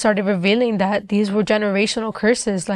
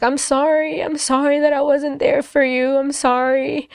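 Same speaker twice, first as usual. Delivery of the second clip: high-pitched imitation of a crying apology; retold tearful apology; 5 semitones higher than usual. Mom apologizing to her daughter, retold tearful apology. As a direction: apologize as if weeping, pitched up throughout. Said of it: "she just began crying".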